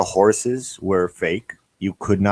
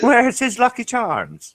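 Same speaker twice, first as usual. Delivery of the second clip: much higher put-on wee Scottish voice; mock Scottish caricature; slower than usual. Teasing his friend in a high mock Scottish voice. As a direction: squeak it out like a cartoon Scot.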